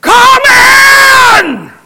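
very high, very loud shout upward; man yelling his prayer at heaven. Retold or imitated. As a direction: call up to the sky with full force. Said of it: "He just looked up and said".

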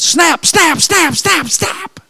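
high, sharp snap snap; snapping mousetraps. Retold or imitated. Counselor stepping on every mousetrap: high sharp snaps. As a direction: spit out each snap like a trap springing.

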